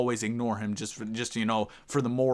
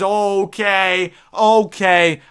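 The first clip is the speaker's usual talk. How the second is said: excited okay; 10 semitones higher, 13 dB louder, much slower than usual. Real, not acted. Excited by trade options.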